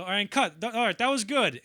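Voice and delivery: director calling cut. Retold or imitated.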